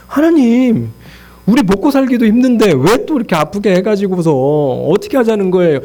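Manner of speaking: high-pitched acted whine to God; aggrieved complaint. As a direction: protest as if at wit's end. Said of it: "이거의 계속된 반복인 거예요".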